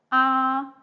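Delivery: loud "ah" held high and level; first tone. Shown as a demonstration. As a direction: sustain "ah" high without any glide. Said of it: "all these four main tones".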